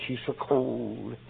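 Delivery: slow, soft, grief-stricken voice; lingering softly over the cold body; grieving daughter. Retold or imitated. Grieving daughter at mother's coffin.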